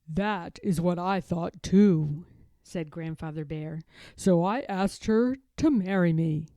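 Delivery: put-on a gentle old bear showing an old photo; Grandfather Bear. Grandfather Bear showing his grandson a photo, fond old bear voice.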